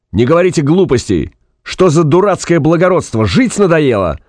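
high-pitched exasperated outburst; angry shouting. A narrator's reading. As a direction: scold a stubborn man over the din. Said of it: "Фанк поперхнулся от крика". (раздражённый крик)

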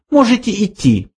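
high-pitched careless dismissal; offhand mouse. A narrator's reading. Mouse dismisses the old man, offhand high voice. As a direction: dismiss lightly in a high little voice. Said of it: "небрежно произнёс Бенджи".

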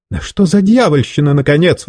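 high indignant protest; indignant outburst. A narrator's reading. Kidnapped teacher protests to his captor, indignant. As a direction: let the outrage burst out. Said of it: "возмутился Варбек".

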